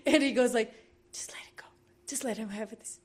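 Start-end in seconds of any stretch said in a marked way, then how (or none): 1.1-3.0 very soft, voiceless whisper, imitating a companion; hushed retold whisper. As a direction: whisper it like discreet advice. Retelling the Russian's discreet advice.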